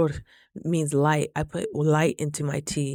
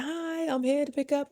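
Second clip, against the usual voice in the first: sweet greeting; 9 semitones higher, 4 dB quieter than usual. Retold or imitated.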